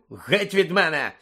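sharp revulsion; disgusted rebuff. Acted. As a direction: push him away with the voice, in disgust. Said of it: "з відразою відштовхнув".

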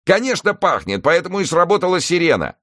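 high-pitched, exasperated retort. Acted. Newson explaining the siren to the operator, exasperated. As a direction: argue back, voice pushed right up. Argues with fire service on phone.

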